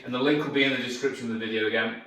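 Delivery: ordinary talk, nothing marked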